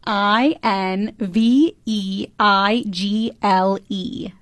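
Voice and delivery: slow, letter-by-letter spelling. Shown as a demonstration. Host spells the quiz word for a stumped co-host, slow spelling. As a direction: spell it slowly, one letter at a time. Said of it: "Spell it for me".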